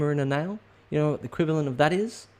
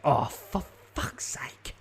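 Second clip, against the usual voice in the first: slow breathy whisper under the breath; whispered kid swear; 3 semitones higher, much slower than usual. Shown as a demonstration. Kid muttering so parents won't hear, slow whisper. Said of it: "that's a kid saying".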